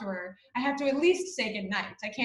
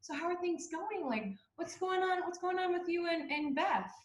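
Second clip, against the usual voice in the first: higher and softer, coaxing mom voice; imitating a soft, careful mother; gentle; 7 semitones higher, 6 dB quieter than usual. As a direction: ask sweetly, tiptoeing around it. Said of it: "she thinks that she's being so gentle".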